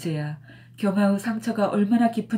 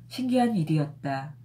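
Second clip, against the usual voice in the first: ordinary delivery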